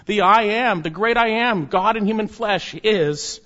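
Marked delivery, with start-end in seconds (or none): 0.2-1.7 "I am" rings out louder and higher each time; emphatic I am. Shown as a demonstration. Preacher proclaiming the great "I am" to believers, emphatic. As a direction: hammer "the great I am" home. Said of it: "It's emphatic".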